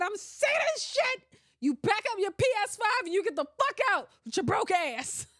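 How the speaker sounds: high, loud acted tirade; fed-up outburst. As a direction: rant like a girlfriend kicking him out. Acting out a girlfriend's fed-up outburst.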